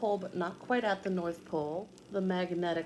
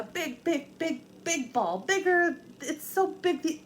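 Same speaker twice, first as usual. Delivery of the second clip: growing excitement; 8 semitones higher than usual. Real, not acted. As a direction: pitch climbing higher with each big. Teacher stressing how big Earth is.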